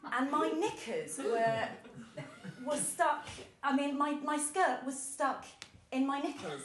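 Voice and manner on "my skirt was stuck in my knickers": ordinary talk, nothing marked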